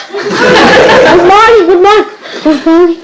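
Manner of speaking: high, slow, laughing put-on drag of a greeting; hammed-up mournful good morning, very slow and high; mock gloomy greeting. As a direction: drawl it out like a sulky child greeting mother, laughing. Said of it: "Is this the way to greet your mother?".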